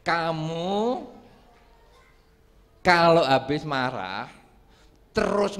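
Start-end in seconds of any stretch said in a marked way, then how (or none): none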